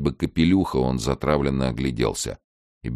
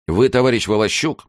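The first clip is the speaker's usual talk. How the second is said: higher, louder formal address; reproachful; 10 semitones higher, 6 dB louder than usual. Acted. Lieutenant breaking the silence to the chairman, reproachful. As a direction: open a pointed reprimand.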